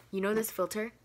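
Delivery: ordinary talk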